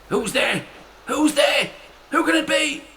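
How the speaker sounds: giant's wife. A narrator's reading. Giant's wife asking who knocks. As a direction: call out suspiciously at the door.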